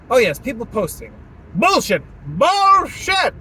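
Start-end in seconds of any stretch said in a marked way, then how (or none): 1.5-3.3 loud, high cry, climbing on the repeat; exclaimed outburst. Real, not acted. Driver calling out people's bragging posts, loud outburst. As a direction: call it out louder and higher the second time.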